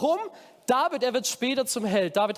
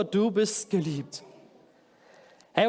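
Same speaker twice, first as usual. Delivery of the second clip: ordinary talk, nothing marked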